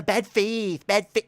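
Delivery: lightly put-on mimicking voice; mocking imitation. Critic imitates opponent mocking the way they speak, mock taunt. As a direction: parrot it back as a mild taunt. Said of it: "Literally mocking the way I speak".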